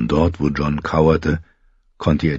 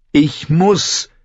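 high, fervent exclamation; impassioned insistence; 11 semitones higher than usual. A narrator's reading. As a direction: cry it out with conviction. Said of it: "rief John inbrünstig".

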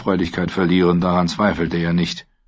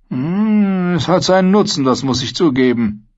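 much higher, sly musing voice; mercenary conceding he likes gold, higher; greedy sellsword; 9 semitones higher than usual. A narrator's reading. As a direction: admit it with greedy relish.

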